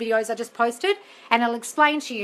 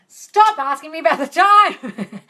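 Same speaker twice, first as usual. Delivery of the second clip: loud, higher, drawn-out protest; exasperated outburst; 5 semitones higher, 8 dB louder, slower than usual. Real, not acted. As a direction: cry out in playful exasperation. Exasperated answer to repeated timing question.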